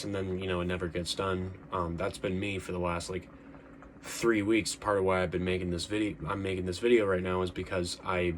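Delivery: ordinary talk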